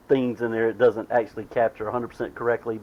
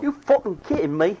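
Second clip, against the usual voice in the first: much higher mock Jersey "You fucking kidding me?"; Jersey outburst; 9 semitones higher than usual. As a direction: toss it back in a playful Jersey voice.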